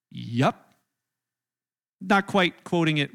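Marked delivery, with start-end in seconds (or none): 0.1-0.7 deadpan reply. Retold or imitated. Jesus answering curtly.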